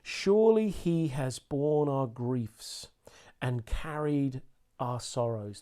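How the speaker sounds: slow, deliberate scripture reading; slow reverent Bible reading; very slowly. Shown as a demonstration. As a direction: read each phrase slowly and let it land. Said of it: "Let me read this very slowly to you".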